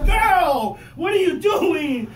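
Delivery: high, loud, slow complaint yelled at her; mock shout. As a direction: bellow it in comic disgust. Yelling at wife over fart.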